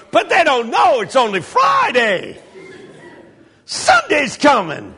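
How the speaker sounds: high, rousing mock-sermon shout; preacher's shout. Pastor's sermon builds before the church, high shouted refrain.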